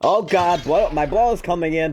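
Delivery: usual speaking voice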